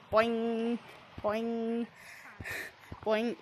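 low, soft boing noises; boing sound effect. Pretend. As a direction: say boing like a bouncing spring. Imitating jump sound.

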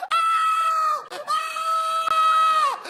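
high, loud mock screaming; wife's scream. Wife seeing two heads at the window, high mock screaming. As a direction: shriek like a terrified wife. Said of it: "My wife was".

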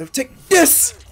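high, loud attack yell; battle shout. Real, not acted. Gamer striking back at a zombie, battle shout.

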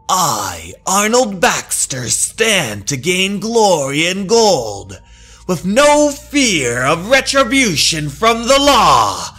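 loud, high, boastful villain; gloating villain. Acted. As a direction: crow over the stolen gold. Villain boasting of his plans.

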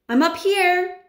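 high, loud call from up a tree; boy calling down from the treetop, loud and high; shouted reply. A narrator's reading. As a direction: shout down to grandmother far below. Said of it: "I shouted back".

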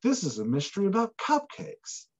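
light, lifted mock cheerful voice; cheery cover voice. Book cover pitching itself in a light, cheery voice. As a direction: sell it like a sweet, harmless cozy book. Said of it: "or the cover is like".

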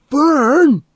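very high, loud accusing yell; outraged high-pitched shout; terrible accusing cry. A narrator's reading. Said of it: "cried in a terrible, accusing voice".

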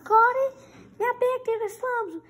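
high, loud bullying bark; a boss putting the kid in her place; bossy threat. Acted.

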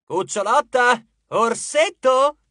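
high, loud, drawn-out pet names; shouted taunt. A narrator's reading. Percy goads the goddess with pet names, shouting. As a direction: call out endearments to needle. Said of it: "gridò".

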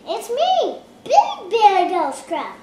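loud, bold goat voice; loud big goat. Acted. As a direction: announce it boldly.

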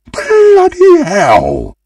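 very high, drawn-out outraged yell; hammy shriek of dismay. Acted. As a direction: stretch it into a big high howl. Reacts to losing the game.

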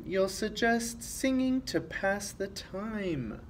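usual speaking voice

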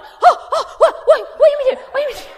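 hammed-up high, loud shrieking; panicked screaming. Husband climbing over to fallen wife, imitated screaming panic. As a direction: imitate a frantic man screaming in alarm. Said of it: "he always screams".

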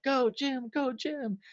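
high, eager cheer; bright encouraging call. Retold or imitated. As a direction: egg him on from across the stage. Bandmate cheering him on.

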